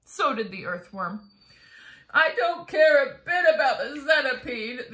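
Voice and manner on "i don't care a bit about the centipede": loud, hammed-up blubbering; sobbed. A narrator's reading. Earthworm grieves for the boy, mock sobbing. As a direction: bawl it out, acting tearful. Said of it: "the earthworm sobbed".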